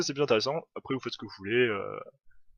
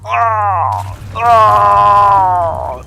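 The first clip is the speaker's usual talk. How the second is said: long, high, full-volume "aaah"; hammed-up kamikaze charge yell; mock battle cry; 8 semitones higher, 16 dB louder than usual. Captain charging without ammunition, battle cry.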